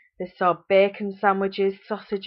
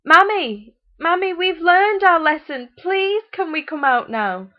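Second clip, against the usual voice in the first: put-on high, loud child's plea; calling out; 11 semitones higher, 9 dB louder than usual. A narrator's reading. Locked-in children calling out to their mother, pleading. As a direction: call out begging from the cupboard. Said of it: "called out to their mother".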